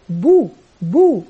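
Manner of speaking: slow, drawn-out بو with lips pushed round. Shown as a demonstration. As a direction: say بو, بو slowly, lips clearly rounded. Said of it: "وتشير إلى الشفتين".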